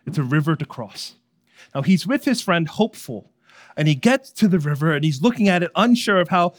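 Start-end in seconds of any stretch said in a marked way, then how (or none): none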